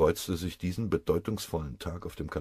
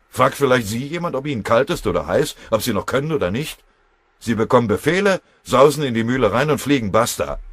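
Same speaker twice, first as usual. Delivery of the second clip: loud, raised-pitch indignant rhetoric; heated tirade; 7 semitones higher, 10 dB louder than usual. A narrator's reading. Foreman telling lieutenant nobody is spared, heated tirade. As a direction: hammer out the hard truth.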